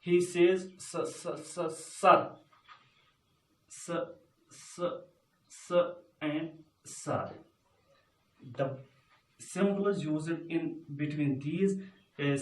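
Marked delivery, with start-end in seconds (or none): 0.8-7.3 soft, halting stutter on the first sound; stammering. Acted. Student struggling to answer the teacher's question, stammering. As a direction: get stuck on the "s" before it comes out. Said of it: "he can't answer in a clear voice".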